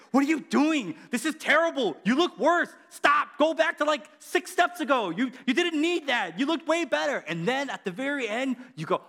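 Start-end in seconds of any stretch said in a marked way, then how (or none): 0.1-7.2 higher and louder, half-shouting; mock exasperated yell. Preacher yelling at a makeup tutorial in mock outrage. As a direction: scold the screen in mock outrage.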